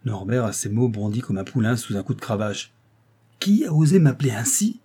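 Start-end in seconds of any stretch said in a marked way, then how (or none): 3.4-4.8 cri furieux, plus aigu; s'écrier d'une voix terrible. A narrator's reading. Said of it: "s'écria-t-il d'une voix terrible". (cri d'une voix terrible)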